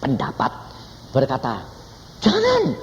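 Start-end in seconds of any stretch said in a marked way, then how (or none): 2.2-2.8 mock voice. Mocking a wrong reading of God.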